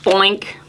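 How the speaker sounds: low comic boink; a silly low bonk sound. Acted. Sound effect for a fall.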